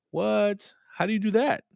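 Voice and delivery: very high, baffled retort; incredulous. Retold or imitated. Student reacting to the teaching, incredulous. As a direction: question it in high-pitched disbelief.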